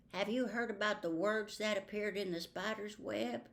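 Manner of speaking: plain reading voice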